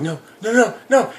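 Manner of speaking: rising into a high, loud laughing plea; mock panic climbing to a shriek; panicked pleas. Victim begging as the zombie attacks, rising mock panic. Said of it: "you hear him going".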